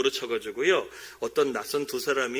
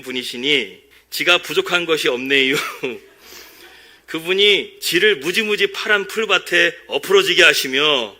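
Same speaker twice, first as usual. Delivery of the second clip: louder, slow Chungcheong dialect reading; 3 semitones higher, 7 dB louder than usual. Shown as a demonstration. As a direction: read the psalm in a Chungcheong drawl, laughing through it. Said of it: "충청도 사투리 말로 한번 제가 한번 읽어드리겠습니다". (충청도 사투리로 웃으며 천천히 읽기)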